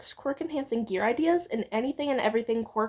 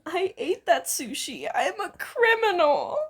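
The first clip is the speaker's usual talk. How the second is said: half wailing; 6 semitones higher than usual. A narrator's reading. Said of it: "half wailing".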